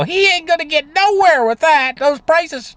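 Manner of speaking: put-on high little girl's grumble with a British accent; complaining little girl. Little girl complaining about inflation, high mock grumble. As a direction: mimic a small English girl griping about prices. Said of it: "she was complaining".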